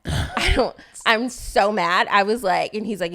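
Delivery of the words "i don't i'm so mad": retold anger. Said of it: "I was so mad".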